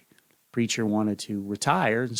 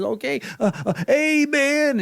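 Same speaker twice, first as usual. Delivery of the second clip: much higher, louder, slow cry; terrified amen; 6 dB louder, slower than usual. Acted. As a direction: blurt it out in sheer panic. Remembers command at cliff edge.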